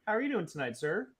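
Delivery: ordinary talk, nothing marked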